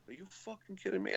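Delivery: retold quiet incredulity; incredulous. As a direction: imitate a stunned man under his breath. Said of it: "he can't believe it".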